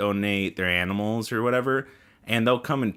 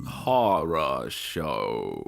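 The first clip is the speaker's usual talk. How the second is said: lower, very slow spooky echo; mock deep announcer; 8 semitones lower, much slower than usual. Cohost echoing the show title, deep and slow. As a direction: drag out the words like a horror trailer.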